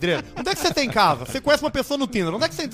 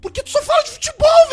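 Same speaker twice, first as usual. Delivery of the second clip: high, loud, mock-annoyed shout; exasperated outburst; 7 dB louder than usual. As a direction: blurt it out high and loud in playful frustration. Teasing complaint to friend.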